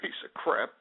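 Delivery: low, soft grumble; muttered curse. Real, not acted. Host cursing to himself between shout-outs, muttered curse. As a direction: mutter it under your breath, disgusted.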